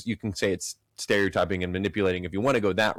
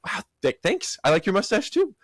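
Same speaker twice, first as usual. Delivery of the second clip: mock surprised thanks; 10 semitones higher than usual. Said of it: "the Indian guy would be like".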